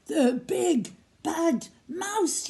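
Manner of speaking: high, slow, drawn-out yell; frightened yell. Acted. Gruffalo's child sees the mouse's shadow, frightened yell. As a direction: cry out in fright. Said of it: "yelled the Gruffalo's child".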